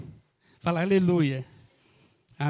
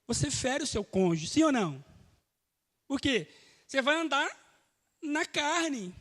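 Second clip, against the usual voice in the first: ordinary talk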